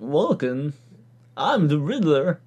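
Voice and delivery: odd, halting impression with a lifted pitch; mock-eccentric villain announcing himself; Christopher Walken. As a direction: do a hammy celebrity impression. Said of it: "That's my Walken impression".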